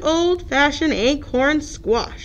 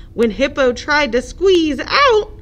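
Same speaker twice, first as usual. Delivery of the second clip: ordinary delivery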